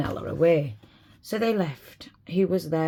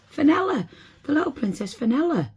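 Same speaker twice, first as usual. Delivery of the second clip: high, louder cry of wonder; amazed shout; 11 semitones higher, 4 dB louder than usual. Acted. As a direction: call out her name in amazement. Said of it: "they shouted in wonder and amazement".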